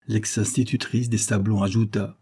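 ordinary delivery, nothing marked

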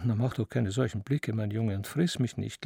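plain reading voice

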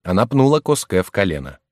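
plain reading voice

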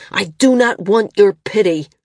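loud, enraged refusal; rage. A narrator's reading. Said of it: "his face contorted in rage".

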